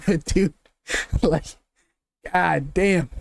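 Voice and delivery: ordinary talk, nothing marked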